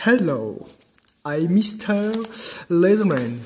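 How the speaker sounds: put-on reading an English line with a heavy French accent. Reading the English leaflet to viewers, French-accented English. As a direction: sound it out like a shy Frenchman reading the box. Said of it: "excusez-moi pour mon anglais".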